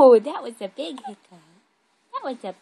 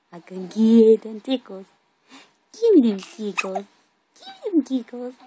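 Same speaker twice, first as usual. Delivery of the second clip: loud teasing tickle threat; loud playful baby talk; 15 dB louder than usual. Pretend. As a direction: boom it out like a tickle monster.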